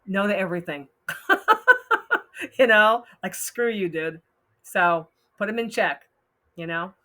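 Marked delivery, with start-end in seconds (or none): 1.1-2.5 high squealing laugh; high burst of laughter. Real, not acted. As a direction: laugh out loud, high and bright. Laughing at own joke.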